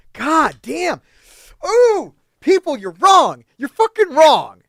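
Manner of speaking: loud, high, slow yelling at the internet; real rant shouting at viewers; angry outburst. Real, not acted. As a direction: blow up at the critics. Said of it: "I'm getting pissed".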